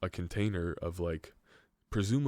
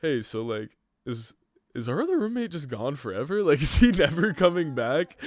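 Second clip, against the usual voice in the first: nervous question; 8 semitones higher, 8 dB louder than usual. Retold or imitated. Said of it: "elephant in the room of like".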